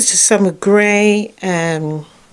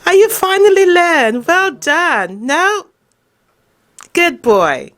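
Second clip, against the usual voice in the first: praising the dog; 9 semitones higher than usual. Real, not acted. Owner praising her dog for finally learning, excited and high. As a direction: gush over the dog's progress.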